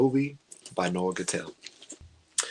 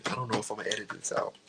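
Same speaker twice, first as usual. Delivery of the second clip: ordinary talk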